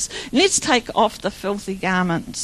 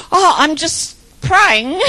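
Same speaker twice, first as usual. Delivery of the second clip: slow, higher, louder acted-out excuse; mock-innocent explanation, breaking into a laugh; sheepish reply; 4 semitones higher, 5 dB louder, slower than usual. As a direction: play caught in the act, drawing it out. Acts out her sheepish reply to dentist.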